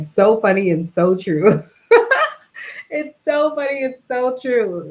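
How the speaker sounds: laughing through the words, pitch climbing high; tickled, breaking into a real laugh; amused laughter. Real, not acted. Laughing at grandma's remembered advice.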